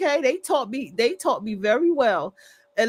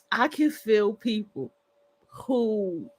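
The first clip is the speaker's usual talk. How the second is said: ordinary talk, nothing marked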